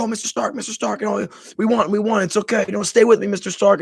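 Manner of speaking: lightly voiced teen begging his mentor; pleading Peter. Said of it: "He's like".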